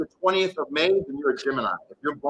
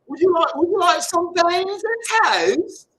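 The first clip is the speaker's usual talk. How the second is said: high, posh-ish offer of beans and toast; hammed-up British accent, pitched high; mock British accent; 10 semitones higher than usual. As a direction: ham up a British host.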